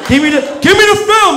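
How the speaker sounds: high, loud, fast shout; demanding the film from the photographer, shouting. Retold or imitated. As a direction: yell it at him, fast. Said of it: "And I'm like".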